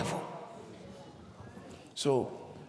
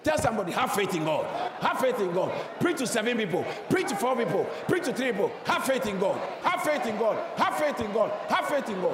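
shouted, higher preaching refrain; repeating louder; 8 semitones higher than usual. Real, not acted. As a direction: preach it loud to the crowd. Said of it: "Say it louder".

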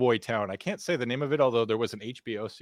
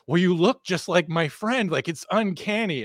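put-on insistent drunk stranger; 7 semitones higher, slower than usual. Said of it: "And he goes like".

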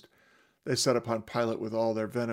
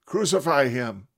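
loud shouted demand; mob shout; 3 semitones higher, 8 dB louder than usual. Acted. Crowd shouting back at Pilate to crucify. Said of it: "They shouted back".

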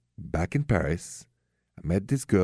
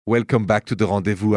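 ordinary talk

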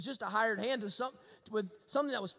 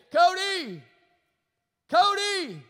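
loud, high calls of the name across the woods; dad calling out; 8 semitones higher, 10 dB louder, much slower than usual. Retold or imitated. Dad calling his lost son home through the dark woods, loud and high. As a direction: call out like a dad searching in the dark. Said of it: "I began to hear the faint voice of my dad saying".

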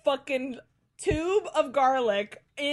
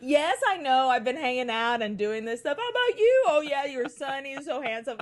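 lively mock gossip, pitch leaping high; gushing, hammed-up small talk; gossiping on phone; 4 semitones higher, 3 dB louder than usual. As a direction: imitate a chatty old lady on the phone.

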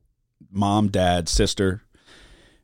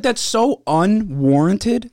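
mock indignation; 7 semitones higher, much slower than usual. Mock prissy reaction.

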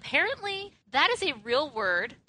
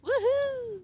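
excited cheer. Real, not acted. Cheering a party trick.

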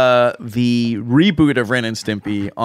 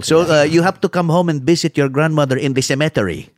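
put-on Filipino accent; 2 semitones higher than usual. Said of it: "an accent that you're trying to sound not Filipino".

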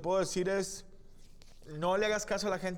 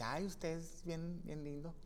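much softer, slow coy voice; imitating flirty woman; 11 dB quieter, slower than usual. The woman at the club, soft coy voice. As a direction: coo it softly and slowly. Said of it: "se pega a tu pecho y te dice".

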